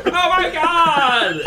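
long, high, loud wail; agonized scream. Acted. Father takes a bullet, screaming in shock. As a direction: scream it out slowly as you are hit.

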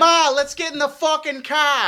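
loud, high mock New York accent, laughing. Telling Ma to get in the car, hammed-up New York accent. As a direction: yell at Ma like a hammed-up New Yorker. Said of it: "That's New York as fuck".

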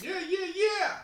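squeaky high happy chant; silly high cheer. Pretend. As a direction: cheer like a tiny cartoon fan. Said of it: "happy and sad about our teams".

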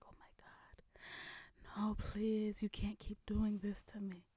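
soft whispered pleading man; whispered mock begging. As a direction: beg softly, imitating him. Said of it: "And he was like".

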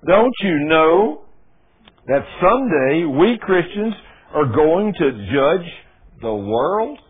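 slow, loud, drawn-out preaching of the verse; preaching scripture. Acted. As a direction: thunder the verse out slowly. Preacher reads scripture emphatically.

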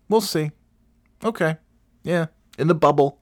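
high, mock-doubtful we'll see; sarcastic doubt. Real, not acted. To listeners, doubting the NBA restart, high sarcastic. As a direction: roll your eyes at it.

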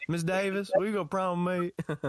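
put-on high, timid boy asking teacher out; asking someone out. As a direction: plead like a lovestruck schoolboy.

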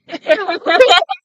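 loud high laugh; bursting laugh. Real, not acted. Noticing a little fan.

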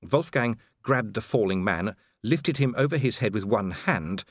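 ordinary delivery, nothing marked

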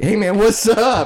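high, drawn-out imitation of an eager greeter; hearty greeting. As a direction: stretch it out like hailing an old buddy. Imagining the daily friendly greeting.

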